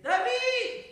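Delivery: loud, high call to a sleeping son; mother hollering for her son; shouted summons. Acted. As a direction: yell the name to wake him. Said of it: "צועקת".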